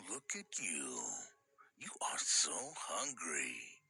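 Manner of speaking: breathy low whisper. Acted. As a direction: whisper it, slow and creepy. Husband voiced whispering.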